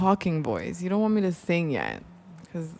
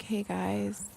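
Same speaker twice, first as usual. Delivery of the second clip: soft breathy greeting; 2 semitones higher, 6 dB quieter than usual. Retold or imitated. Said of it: "[name]'s, like, talking voice".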